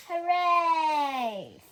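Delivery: loud, high cheer; children cheering. A narrator's reading. Children cheering the lion's return, loud happy shout. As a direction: shout it out like a happy crowd of kids. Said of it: "said the children".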